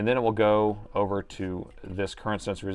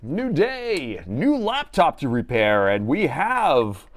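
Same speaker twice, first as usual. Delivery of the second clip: higher, slow, drawn-out radio countdown host; Casey Kasem style; 5 semitones higher, 4 dB louder, much slower than usual. Acted. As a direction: introduce the laptop like a countdown show. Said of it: "like I'm Casey Kasem or something".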